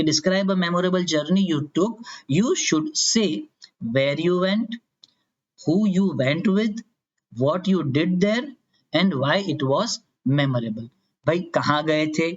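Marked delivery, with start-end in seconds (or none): 0.0-10.9 cue card reading. Shown as a demonstration. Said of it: "लिखा हुआ आएगा कि".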